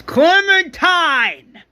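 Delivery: high, drawn-out furious scream; all-out retold scream of rage. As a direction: scream the name, stretched out, then curse her. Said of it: "Sophie screams".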